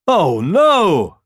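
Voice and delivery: high, loud dismay; crying out. A narrator's reading. Boars hearing the deer's news, crying out. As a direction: wail it in alarm. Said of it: "cried the boars".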